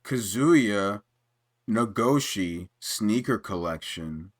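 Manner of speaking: low Kazuya voice. Retold or imitated.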